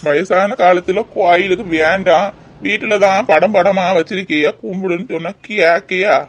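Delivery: put-on slow, drawn-out elderly grumble; grumbling old man. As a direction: nag your wife like a weary old husband. Plays grumbling old husband.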